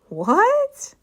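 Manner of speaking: high, loud astonished what; surprised exclaim. A narrator's reading. Class reacting to Maria's idea, loud surprised what. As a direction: everyone blurts it in surprise. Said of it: "everyone exclaimed".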